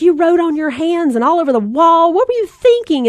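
mock-yell at the kid who drew on the wall; exasperated mom scolding, rising to a high squawk; scolding holler. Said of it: "And then you holler at 'em".

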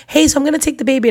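a nurse chirping innocently; lightly voiced chirpy nurse; sweet nurse voice. Nurse returns.

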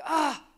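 high loud crying wail; loud anguished wail. Retold or imitated. Bawling in someone's office, a loud crying wail. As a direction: let out a sob of despair. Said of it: "I'm bawling my eyes out".

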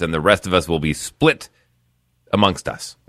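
ordinary talk

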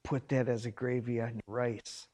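softly telling the wife a tip at dinner; retold whisper. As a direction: drop the voice as if sharing a secret at the table. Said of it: "I whispered to my wife".

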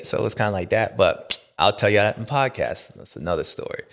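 normal talking voice

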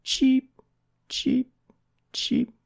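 high, small pleading cheeps; whimpering chick. A narrator's reading. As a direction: whimper in tiny bird peeps. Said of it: "whimpered Jerry".

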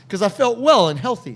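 loud, high laugh spilling into the words; big laugh. Real, not acted. Laughing to co-host about skipping class after getting well. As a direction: laugh hard at your own confession.

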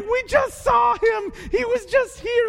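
high, slow, shouted disbelief; high shouted mock excitement; breathless excitement. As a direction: yell it as you run to tell the others. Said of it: "They go running".

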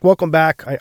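ordinary delivery

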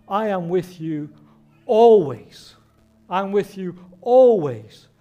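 ALWAYS boomed out loud and drawn out; emphatic promise. Retold or imitated. Preacher quoting Jesus's promise to his disciples, emphatic on ALWAYS.

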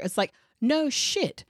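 ordinary delivery

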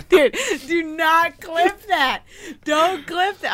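high, laughing, pleading; laughing plea. Real, not acted. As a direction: beg through laughter, slow at first. Laughing plea to not clip.

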